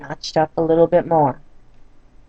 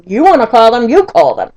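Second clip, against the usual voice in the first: louder, biting snap back; angry retort; 4 semitones higher, 9 dB louder than usual. Retold or imitated. Wife snapping at husband about calling the doctor, louder. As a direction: throw it back at him.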